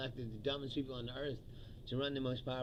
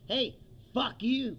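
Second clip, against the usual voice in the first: defiant retort; 9 semitones higher, 8 dB louder, slower than usual. Retold or imitated. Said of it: "a letter back that says".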